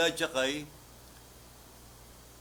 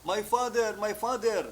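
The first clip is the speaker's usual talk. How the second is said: raised pleading cry, higher; crying out my father; 6 semitones higher, slower than usual. Retold or imitated. Disciple crying out to his mentor, raised pleading call. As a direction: call out to the departing prophet. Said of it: "sumisigaw siya ng".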